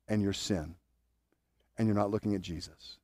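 ordinary talk

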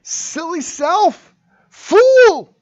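high, loud self-rebuke, slow at first; scolding himself. Real, not acted. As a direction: shout down your own foolish heart. Scolding his own impatient self.

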